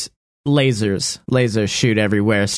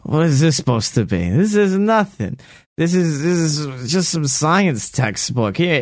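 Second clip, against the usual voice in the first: gangster boss; 3 semitones higher than usual. Acted. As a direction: scoff at the worthless prize like a crime boss. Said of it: "and goes".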